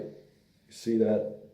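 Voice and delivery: ordinary talk, nothing marked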